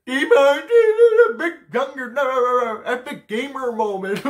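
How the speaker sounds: high, loud and slowed mock nerd cry; dragged-out goofy impression of excited band kids. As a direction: parody them yelling a silly catchphrase. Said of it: "run around and be like".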